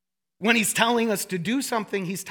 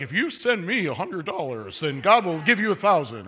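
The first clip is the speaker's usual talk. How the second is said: lightly voiced slick TV preacher, a bit lower; 5 semitones lower than usual. Pastor mocking a TV preacher to the congregation, low mock pitch. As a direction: mimic a TV preacher's money pitch. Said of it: "the preacher on T V is like".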